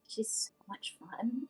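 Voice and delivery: laughing whisper. Real, not acted. Giggling whisper about Fey Queen.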